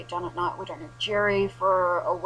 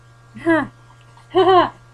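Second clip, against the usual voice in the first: loud laugh; 10 semitones higher, 13 dB louder than usual. Real, not acted.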